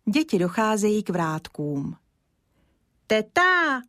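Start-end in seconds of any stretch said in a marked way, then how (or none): 3.1-3.8 put-on child calling out loudly to aunt. A narrator's reading. Kája calls out to the blacksmith's wife, loud and high. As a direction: shout "Teta!" high and loud toward the house. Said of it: "volá Kája hodně hlasitě".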